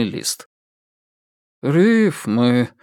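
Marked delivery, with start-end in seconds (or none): 1.6-2.7 a flat, let-down remark; disappointed. Acted. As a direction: say it with mild disappointment. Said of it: "разочарованно замечает он".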